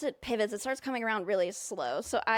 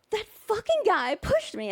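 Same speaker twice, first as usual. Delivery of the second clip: angry; 9 semitones higher than usual. Retold or imitated. Angry telling mom.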